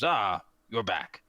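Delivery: gruff friendly orc boss, only a little shifted; hint of an orc voice; lightly voiced orc leader. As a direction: play the big orc welcoming them back.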